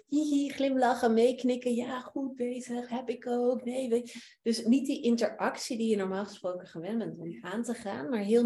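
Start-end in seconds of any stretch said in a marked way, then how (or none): 0.1-1.7 mock eager smiling. Shown as a demonstration. Acting out over-eager nodding. 1.7-4.2 chatty reassuring. Shown as a demonstration. Mimicking chatty interaction.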